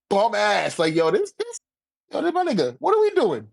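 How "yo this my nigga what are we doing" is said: normal talking voice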